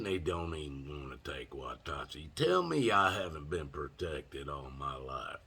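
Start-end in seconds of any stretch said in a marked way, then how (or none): none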